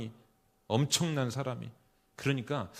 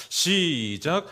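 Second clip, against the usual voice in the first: drawn-out, raised cue to start; call to begin; 4 semitones higher, 6 dB louder, much slower than usual. Real, not acted. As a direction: call the group in, loud and high. Cues congregation to read together.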